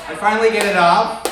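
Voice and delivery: ordinary talk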